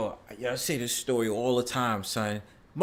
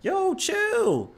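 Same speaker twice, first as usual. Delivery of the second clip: very high "back off" imitation; retold high-pitched protest. Speaker answers the angry strangers from the store, high retold protest. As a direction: replay your plea, pitched way up. Retells his reply to aggressors.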